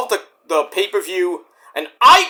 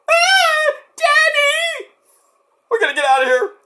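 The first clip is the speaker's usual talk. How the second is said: put-on much higher child voice calling for daddy; scared little kid. Little kids pleading with their daddy, high and scared. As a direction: squeal it like a frightened little kid.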